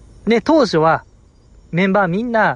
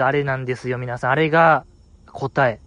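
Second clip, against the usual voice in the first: ordinary talk, nothing marked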